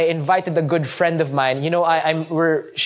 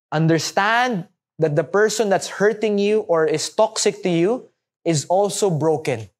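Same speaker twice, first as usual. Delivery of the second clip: usual speaking voice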